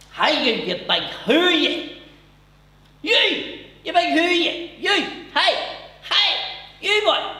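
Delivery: loud high Newry yell; shouting across a bar. Retold or imitated. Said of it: "I heard this thing going".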